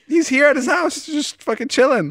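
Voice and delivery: very high, incredulous squeal; slow down and squeak in amazement; starstruck amazement. Retold or imitated. Seeing the movie star relaxed at home, very high starstruck amazement.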